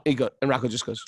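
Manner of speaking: ordinary talk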